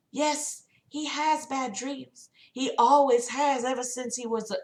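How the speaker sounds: high, soft sister voice; concerned sister. Acted. Voices John's sister explaining his nightmares.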